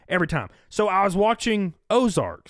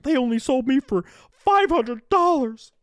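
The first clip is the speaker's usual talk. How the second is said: mocking whine; 8 semitones higher than usual.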